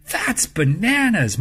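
loud, drawn-out cry of disbelief; cried out. A narrator's reading. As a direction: stretch out the dismay. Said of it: "Margaret cried".

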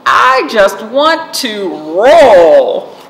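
slow, loud stretched-out wish; dreamy Acorn. A narrator's reading. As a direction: draw out the longing to roll. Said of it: "said Acorn".

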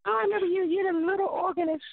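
high mock female voice; wife asking who is calling; imitating wife. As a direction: ask puzzled in her high voice. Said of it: "I was like".

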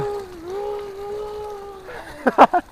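squealing high laughter. Real, not acted. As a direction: burst into a high-pitched laugh. Laughing hard on bike ride.

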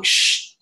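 sharp voiceless shushing; acted "shh" cutting off an objection; retold shush. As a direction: imitate hushing someone into silence. Retold Swami silencing the boy.

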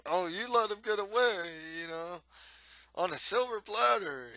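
high-pitched, exaggerated mock whining; mocking whine. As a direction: imitate a whiner complaining, pitch swooping up high. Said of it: "it's almost like he's whining".

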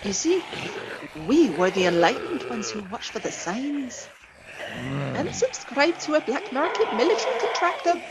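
put-on high, smug old lady dog; terrier lady voice. A narrator's reading. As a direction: explain it like a smug insider. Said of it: "the female terrier said".

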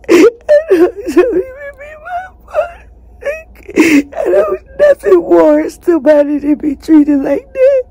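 high, loud crying plea; sobbing loudly, voice cracking high. Real, not acted. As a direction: wail it out through sobs, desperate.

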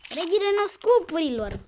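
high, loud victory proclamation; triumphant boast. Real, not acted. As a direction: crown yourself champion out loud. Crowns self after a shot. (proclamație triumfătoare, cu glas ridicat)

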